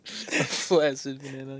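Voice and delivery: slow, amused echo after a laugh; amused repeat. Real, not acted. Friend echoing the joke about his walk, amused. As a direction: savour the silly phrase slowly.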